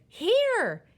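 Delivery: high, loud answering yell; loud childlike shout; insistent call. A narrator's reading. Child shouts back again.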